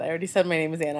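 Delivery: ordinary talk, nothing marked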